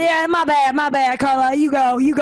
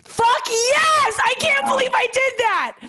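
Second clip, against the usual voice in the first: triumphant yell; 13 semitones higher, slower than usual. Real, not acted. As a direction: shout it out, thrilled you got it right. Guessed the movie title right.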